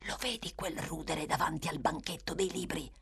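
secretive whispered question. Acted. The Dodger shows the boys a mark, whispered. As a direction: keep it under your breath, pointing. Boy points out target secretly.

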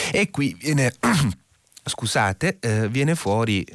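dry throat, needing water; while sipping a drink. Real, not acted. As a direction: voice catching before a sip. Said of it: "prendo un sorso d'acqua".

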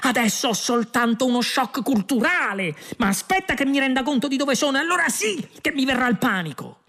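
high, near-hysterical outburst; shouted retort. A narrator's reading. Shocked Earthman warning that panic is coming, high shout. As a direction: rant up high, close to panic. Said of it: "urlò Arthur".

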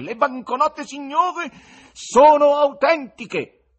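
very high, loud goatish proclamation; bleating goat-like tenor. A narrator's reading. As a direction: stretch the last words out, loudly bleating. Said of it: "con alta voce tenorile da caprone".

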